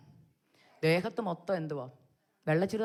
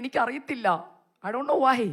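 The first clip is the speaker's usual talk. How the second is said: tearful reply; 5 semitones higher, 5 dB louder, slower than usual. Retold or imitated. Crying, answering her husband.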